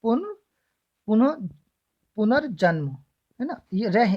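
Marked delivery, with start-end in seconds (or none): 0.0-2.9 पुनर्जन्म built up in pieces with pauses; syllable by syllable. Shown as a demonstration. Teacher sounding out the word for students, segmented. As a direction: break पुनर्जन्म into "pun... puna... punar-janm". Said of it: "क्या होता है?".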